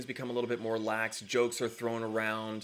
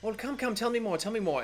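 higher, louder, bubbling with delight; giddy princess, excited to chat; eager excitement; 11 semitones higher, 4 dB louder than usual. Acted. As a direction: pull them in, eager to hear everything. Emma excited, pulls her close.

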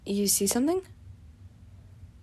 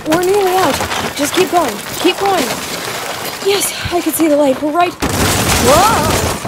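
frantic shouting; 7 semitones higher, 5 dB louder than usual. Acted. Fleeing the collapsing ruin.

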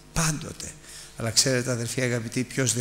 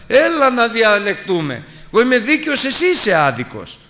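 loud, high, arrogant challenge to God; the boastful man daring God to argue with him, loud and high; defiant challenge; 10 semitones higher, 7 dB louder than usual. Acted. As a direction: throw it at God as an equal, raised and proud. Job's earlier defiant challenge to God.

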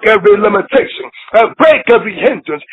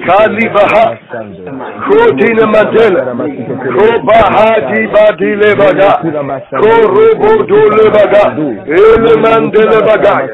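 fervent tongues. Acted.

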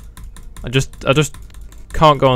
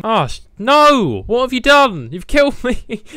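outraged cry; 9 semitones higher, slower than usual. Real, not acted. Killed by teammate.

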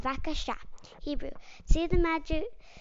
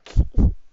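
short breathy laugh; quick unvoiced chuckle; 6 dB louder than usual. Real, not acted. Laughter after untangling ears.

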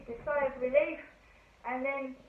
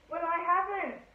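higher, short curt answer; curt reply; 8 semitones higher, 5 dB louder than usual. Acted. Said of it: "said the driver shortly".

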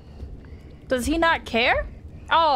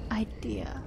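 whispering; 10 dB quieter than usual. Real, not acted. Sneaking, whispering to self.

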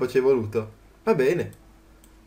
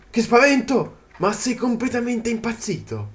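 startled cry; 7 semitones higher than usual. Real, not acted. Gamer jumping at a creature, high startled cry. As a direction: jump at the sudden scare.

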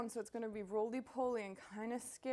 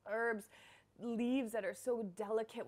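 usual speaking voice

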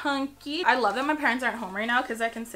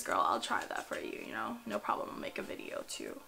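whisper; 13 semitones lower, 10 dB quieter than usual. Real, not acted. Aside to brand, whispered.

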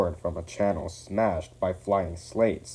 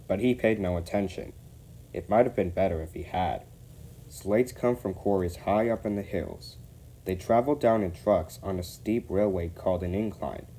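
usual speaking voice

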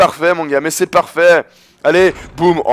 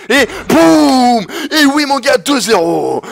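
high, loud victory cry; triumphant shout; 8 semitones higher, 4 dB louder, much slower than usual. Real, not acted. Gamer gloating at his opponent, loud triumphant cry. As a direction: crow over the basket like a champion. Celebrating a scored point.

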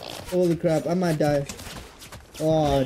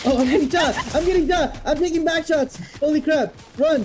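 higher, panicked cries under fire; panicked yelling; 6 semitones higher than usual. Real, not acted. Gamer under fire yelling in panic. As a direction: yell in panic as the shots hit. Getting shot in game.

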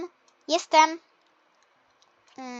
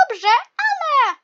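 choking cough; 7 semitones higher, 6 dB louder, slower than usual. Acted. Choking on bubble tea.

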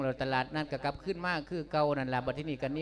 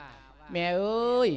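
calling out; 6 semitones higher, 5 dB louder, much slower than usual. Acted. Son calling his mother.

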